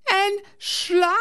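very high frightened shriek; panicked cry. A narrator's reading. Girl repeats her warning to the pilot, very high and frightened. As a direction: squeal it in terror. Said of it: "Hanna panics".

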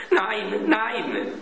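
a child's lightly voiced plea of no, no; cry of protest. A narrator's reading. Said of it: "schrie Klaus".

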